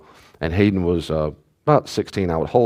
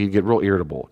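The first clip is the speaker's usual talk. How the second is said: ordinary talk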